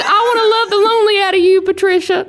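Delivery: high, loud mock declaration of love; imitating grandpa. Singer jokingly voicing Pa to Nana, over-the-top declaration. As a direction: declare it, hammed up. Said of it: "But my pa said".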